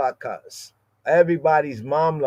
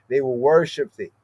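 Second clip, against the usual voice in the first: ordinary talk, nothing marked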